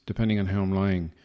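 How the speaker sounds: ordinary talk, nothing marked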